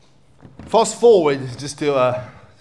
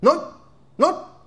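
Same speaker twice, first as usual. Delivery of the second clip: emphatic high cry; 14 semitones higher than usual. Acted.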